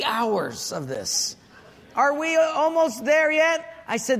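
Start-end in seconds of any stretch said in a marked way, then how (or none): 1.9-3.6 put-on whiny kid. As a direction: whine the question up high. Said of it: "kids nagging".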